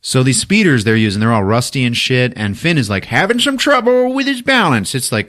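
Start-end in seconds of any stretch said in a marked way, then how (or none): 3.1-4.9 pitched way up, off balance; comic wobbly telling. Retold or imitated. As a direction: say it high as if losing balance.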